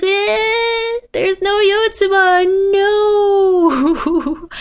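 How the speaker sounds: put-on high, wailing little-girl cry; panicked child voice. Child noticing her bike is empty, high whiny wail. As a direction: whimper it like a lost kid. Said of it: "which is like".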